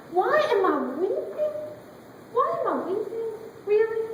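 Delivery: incredulous. Acted. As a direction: she can't believe they're asking. Mary answers the angels in disbelief.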